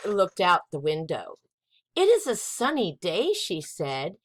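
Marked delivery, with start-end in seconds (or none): 2.0-3.3 lightly voiced mother. Mother Joan voiced in story.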